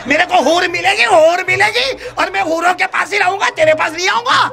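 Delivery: high-pitched comic angry shout; angry husband. A narrator's reading. Husband angrily shouts at his wife that he'll get houris. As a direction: yell it at the wife, hamming up the anger. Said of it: "تو یہ تو غصے میں تھا نا".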